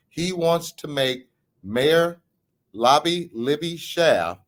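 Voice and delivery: slow, spaced-out announcement with long pauses; measured and emphatic, letting each phrase land; deliberately slow. Shown as a demonstration. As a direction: spell it out slowly for the audience, piece by piece. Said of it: "I'm gonna say it slowly".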